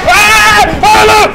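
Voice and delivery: loud high yelp of alarm; real jump-scare shriek; startled scream. Real, not acted. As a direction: cry out in sudden fright. Jump scare in horror game.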